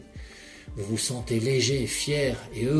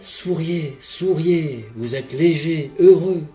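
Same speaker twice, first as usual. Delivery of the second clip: swelling louder to a bright cry on heureux; building elation; joyful urging; 5 semitones higher, 8 dB louder than usual. Acted. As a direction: pump the listener up with joy. Urging joy in the visualization.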